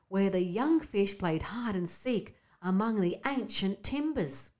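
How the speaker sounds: usual speaking voice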